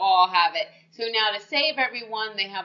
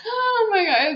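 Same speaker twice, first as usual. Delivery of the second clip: laughing; 12 semitones higher, 6 dB louder than usual. Real, not acted. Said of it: "overwhelmed laughing".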